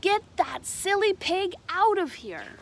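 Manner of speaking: high, loud, cross teacher's order; stern teacher. A narrator's reading. Teacher scolds Megan over the baby pig, loud high order. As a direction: call Megan out from the front of the class. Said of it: "The teacher said".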